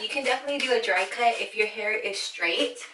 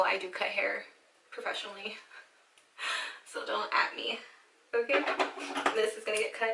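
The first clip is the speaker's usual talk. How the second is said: usual speaking voice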